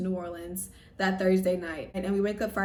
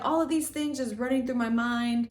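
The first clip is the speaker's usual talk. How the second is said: ordinary delivery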